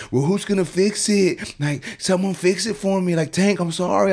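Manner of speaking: mock plaintive, high-pitched begging; pleading client. Client begging barber to fix the haircut, pleading. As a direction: imitate a desperate customer up high.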